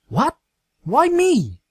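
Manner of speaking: high, stunned outburst; shocked disbelief. A narrator's reading. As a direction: recoil in disbelief. Dash shocked at being chosen.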